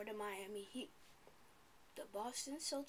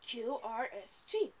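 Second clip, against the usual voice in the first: excited; 7 dB louder than usual. Shown as a demonstration. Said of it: "What kind of a voice are we gonna use?".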